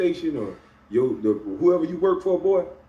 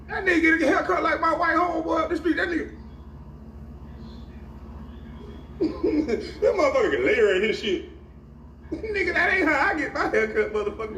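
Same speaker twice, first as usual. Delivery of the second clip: much higher, laughing mock gossip. Imitating barbershop gossip in a much higher voice through laughter. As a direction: cackle through the put-down.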